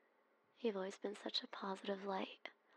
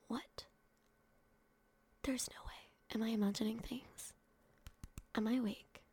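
can't believe who's there; whispered shock at the door; stunned disbelief. Acted. Disbelief at visitor.

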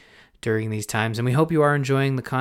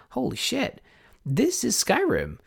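much higher, slower astonished exclamation; retold amazed realization; 12 semitones higher than usual. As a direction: gasp out the recognition in disbelief. Inner quote of sudden recognition.

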